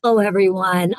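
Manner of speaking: normal talking voice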